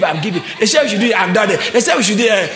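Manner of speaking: normal talking voice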